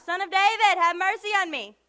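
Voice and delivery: yelling out. Acted. Blind man who would not stop, yelling out to Jesus. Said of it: "He was yelling out".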